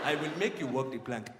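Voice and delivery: low mock Somali pirate voice; Somali pirate accent. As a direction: play a modern-day pirate with a Somali accent. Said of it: "that's how pirates sound now".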